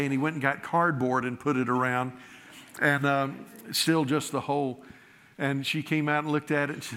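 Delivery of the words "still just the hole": usual speaking voice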